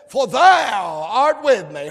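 slow, loud shouted scripture line; preacher shouting the verse; preaching shout. Acted. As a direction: belt it out to the church. Climax of preaching.

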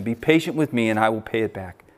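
ordinary talk, nothing marked

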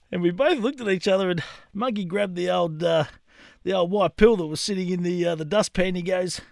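ordinary delivery

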